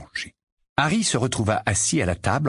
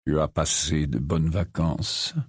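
lower, slow somber man; Sirius's voice; 5 semitones lower, slower than usual. Acted. Sirius asking Harry about his holiday, somber.

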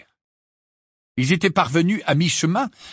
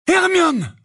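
very high, loud call of alarm; anguished cry; 5 dB louder than usual. A narrator's reading. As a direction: scream a fallen friend's name. Harry sees Hermione collapse. (cri de détresse aigu)

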